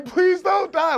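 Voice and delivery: high, loud imitation of a sobbing coach; retold bawling. Imitating his coach bawling over him on the floor, high and loud. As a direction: play the coach breaking down, pleading through tears. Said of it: "he is over me bawling".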